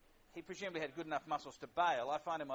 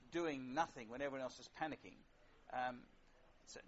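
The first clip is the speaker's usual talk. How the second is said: usual speaking voice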